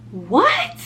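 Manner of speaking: high disbelieving what; stunned. Retold or imitated. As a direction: react in disbelief to the examiner. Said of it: "I was like".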